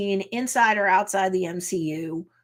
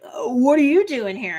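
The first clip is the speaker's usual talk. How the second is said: higher-pitched mock surprise; surprised retort; 4 semitones higher, 4 dB louder than usual. Jen answering Nikki's question, surprised retort. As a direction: throw the question back, imitating astonishment. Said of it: "And Jen's like".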